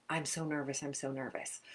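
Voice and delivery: nervous self-talk. Shown as a demonstration. An athlete before a test, quietly nervous to herself. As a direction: mutter your worry under your breath. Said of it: "one group was supposed to think to themselves".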